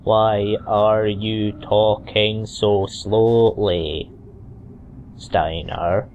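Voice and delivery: very slow, evenly stretched words; painfully slow question; drawn-out slow. Acted. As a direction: drag every word right out. Mock slow reading of game line.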